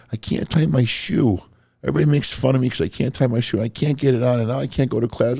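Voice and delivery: high, plaintive whimpering voice; half-crying whimper. Retold or imitated. Classmate Buddy crying over his shoe, high whimper. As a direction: plead like a bullied kid near tears. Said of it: "whimpering, kind of half-crying voice".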